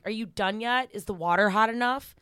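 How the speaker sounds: loud, mock-stern questioning; commanding mistress. Mistress supervising her client at the dishes, loudly commanding. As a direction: throw out orders loudly, playing the boss. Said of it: "throw out these commands".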